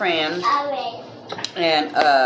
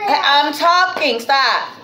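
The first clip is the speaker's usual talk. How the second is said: raised, high-pitched telling-off; 9 semitones higher, 3 dB louder, slower than usual. Real, not acted. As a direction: cut in sharply to stop the interruption. Parent scolds child interrupting the video.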